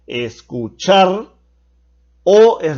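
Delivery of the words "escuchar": slow "escuchar", landing on the final -char; teacher's slow demonstration of "escuchar", weight on the end; last syllable shown. Shown as a demonstration. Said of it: "la última sílaba que pronuncio".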